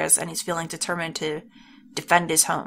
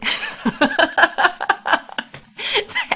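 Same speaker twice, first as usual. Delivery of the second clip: breathy laugh; 11 semitones higher than usual. Real, not acted. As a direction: laugh under your breath, amused. Player reacts to odd farewell.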